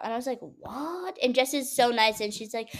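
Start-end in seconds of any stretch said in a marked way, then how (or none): 0.4-1.2 soft, high-pitched baffled question; mock incredulity in a small high voice; retold disbelief. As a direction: squeak it quietly, as if taken aback. Her baffled reaction, retold.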